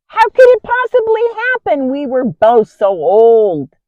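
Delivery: high, loud incredulous outburst; doubting husband. Acted. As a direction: voice his doubt in a loud, high-pitched protest. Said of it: "Zechariah doubted".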